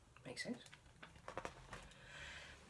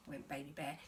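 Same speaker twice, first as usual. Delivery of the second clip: ordinary delivery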